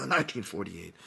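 cough, then slip the words out quietly; fake-cough aside.

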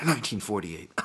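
words slipped out under a fake cough; fake-cough aside. Podcaster hinting at history to listeners, mock-coughed aside.

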